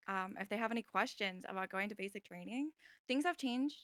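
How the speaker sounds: ordinary talk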